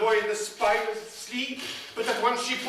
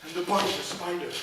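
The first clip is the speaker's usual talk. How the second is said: ordinary delivery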